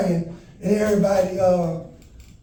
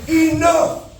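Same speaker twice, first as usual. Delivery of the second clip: higher, louder, slow commanding roar; booming Satan shout; 5 semitones higher, 3 dB louder, slower than usual. Retold or imitated. Satan silencing the arena, booming slow higher shout. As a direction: thunder it out like Satan silencing the crowd. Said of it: "And he said".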